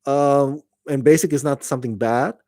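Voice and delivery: usual speaking voice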